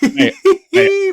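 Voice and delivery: high squeal of laughter; laughing. Real, not acted. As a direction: laugh it out helplessly. Laughing hard at the story.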